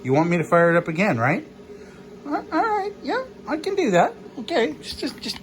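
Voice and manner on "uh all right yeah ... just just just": squeaky high put-on voice answering; high silly voice. Man answering his dog's request in a silly high voice. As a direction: talk back in a goofy high squeak.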